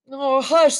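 high, hushing plea; begging. A narrator's reading. Daughter begging her father to hush, pleading. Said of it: "the girl begged".

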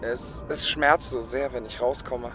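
quietly hurting, voice sinking away; pained confession. Acted. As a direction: confess your suffering, low and subdued. Karim confesses his pain.

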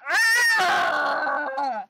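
ordinary talk

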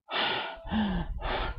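demonstrated deep gasp; big audible breath in; deep inhalation. Shown as a demonstration. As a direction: suck in air to show deep breathing. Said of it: "During deep inspirations".

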